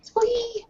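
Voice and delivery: high, soft squee of delight; excited squeal. Acted. Player character squeals when Bettina comes back, high and soft. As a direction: squeal with glee.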